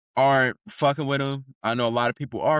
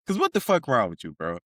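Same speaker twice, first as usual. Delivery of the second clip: ordinary talk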